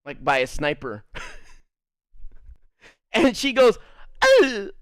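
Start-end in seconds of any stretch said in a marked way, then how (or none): 4.2-4.7 high, louder pained "ugh"; mock pained grunt. Teacher hit by a basketball, high pained grunt.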